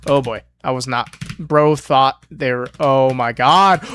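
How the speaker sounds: ordinary talk, nothing marked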